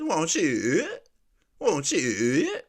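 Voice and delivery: "you" drawn out with a rising tail; mock her stretched ending on "you"; mimicked word endings. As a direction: imitate her "Won't you?", bending up the last word. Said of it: "how she say the end of her words".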